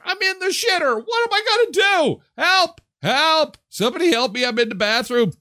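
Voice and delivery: high, frantic cries for help; panicked screaming. Retold or imitated. Trapped in airplane toilet.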